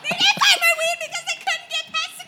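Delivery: high, loud, fast outburst; hammed-up screech of exasperation; frustrated shriek. Snapping back at family question.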